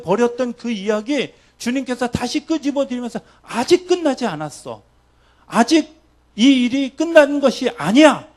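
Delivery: loud, high impassioned sermon climax; preaching at full force. Acted. Preacher urging the congregation, full-voiced. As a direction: build the call until it rings out.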